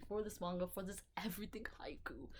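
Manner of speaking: hushed whisper on the last words. Real, not acted. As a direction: drop to a whisper. Sudden whisper.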